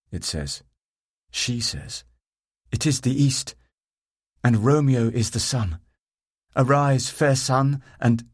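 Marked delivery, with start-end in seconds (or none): 2.7-8.3 declaiming Shakespeare, raised and loud; reciting Romeo. Acted. Woman reciting Romeo's lines beneath the window, declaimed.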